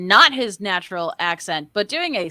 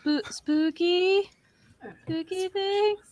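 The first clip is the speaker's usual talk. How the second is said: mock spooky; 10 semitones higher, slower than usual. Mocking the quoted line.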